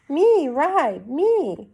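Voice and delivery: put-on high childish pleading; lightly voiced eager toddler. Fudge pleading with his father for another ride.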